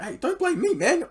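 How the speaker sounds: high, loud protest; defensive yell. Retold or imitated. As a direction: shout your innocence. Defending himself loudly.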